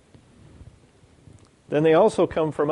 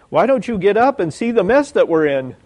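raised, loud mock exasperation; loud, high-pitched panicked complaint; panicked disciples; 5 semitones higher, 5 dB louder than usual. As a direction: demand help in acted panic. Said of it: "These guys have lost it".

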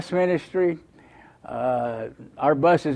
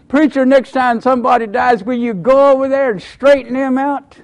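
put-on little boy's plea; 9 semitones higher, 8 dB louder than usual. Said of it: "and he said".

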